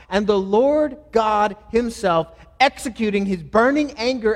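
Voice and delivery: loud, impassioned preacher driving each phrase home; full-force preaching. Real, not acted. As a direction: preach it loudly, hammering the key words.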